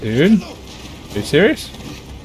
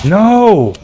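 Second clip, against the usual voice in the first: high, loud, drawn-out no; cry of dismay; 10 semitones higher, 7 dB louder than usual. Real, not acted. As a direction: wail it out as the game goes wrong. Game goes wrong, cries out.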